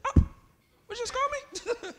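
high squeaky mock disbelief; comic falsetto outrage; mock indignation. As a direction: play the offended disciple, voice shooting way up. Acting Peter's shock at being rebuked.

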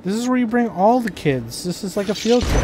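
teasing. Real, not acted. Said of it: "mocks kids in dungeon".

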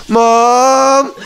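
high, drawn-out call for mom; little sister calling out. Retold or imitated. Little sister calling mom at night, high drawn-out call. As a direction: call out as a child wanting mom. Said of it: "She just kept calling for Mom".

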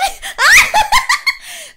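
high, loud shriek of laughter; helpless laughter; loud laughing burst. Real, not acted. Laughs at her fall.

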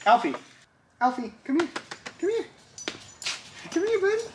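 high, sweet call to a puppy. Real, not acted. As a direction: coax the dog over in a high voice. Calling the puppy over.

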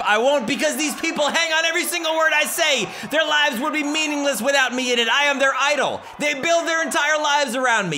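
high-pitched, heated promo rant; defiant boast. Acted. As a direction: proclaim it over them, refusing to back down. Acted wrestling promo, refusing to shut up.

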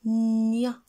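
"nia" with a long, loud nnn sliding into ya; blended syllable. Shown as a demonstration. Teacher blends the first syllable for repeating, "nia" drawn out. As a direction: stretch the n of "nia", then land on ya.

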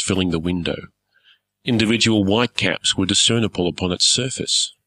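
usual speaking voice

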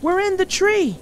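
high, loud call back; child's carrying shout to a parent. A narrator's reading. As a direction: holler where you are. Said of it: "Fox shouted back".

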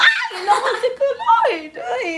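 high, loud laughing shriek; excited squeal. Real, not acted. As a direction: squeal with delighted surprise.

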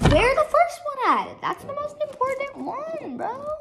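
high-pitched annoyed question; exasperated whine. Real, not acted. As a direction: whine in frustration. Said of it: "I'm really mad".

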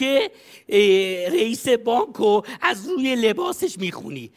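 usual speaking voice

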